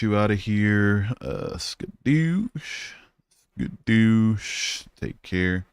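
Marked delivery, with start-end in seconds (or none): 1.6-2.9 high, slow drawn-out skadoosh; high, slow goofy skadoosh; playful skadoosh. Pretend. Playful catchphrase exit. 3.6-4.9 quicker goofy repeat; a second, quicker cartoon skadoosh; quick playful skadoosh. Repeated catchphrase.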